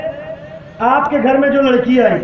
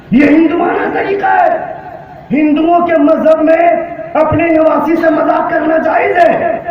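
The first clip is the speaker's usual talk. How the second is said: fiery preaching; 4 semitones higher than usual. Acted. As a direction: drive it home at full force. Preaching at full force.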